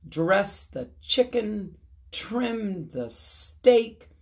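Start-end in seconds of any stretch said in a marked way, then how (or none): none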